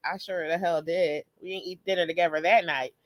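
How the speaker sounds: normal talking voice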